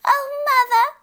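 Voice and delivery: put-on a small fairy child crying for mother; sobbing fairy child. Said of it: "sobbed the little fairy child".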